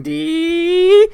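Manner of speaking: screeching violin imitation. Imitating violins in horror music.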